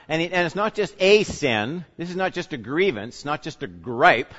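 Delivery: ordinary talk, nothing marked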